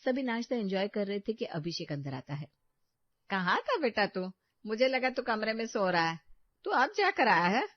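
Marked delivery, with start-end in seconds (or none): 3.3-7.7 mother voiced. Mother questions late son.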